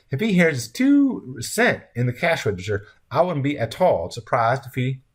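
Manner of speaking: high-pitched country woman running on; talkative diner wife, alarmist. Acted. Voices Red Sam's wife.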